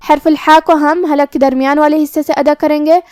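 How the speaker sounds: ordinary talk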